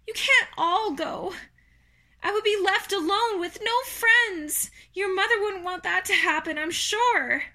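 high, anxious pleading; distressed Wilbur. Acted.

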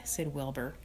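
usual speaking voice

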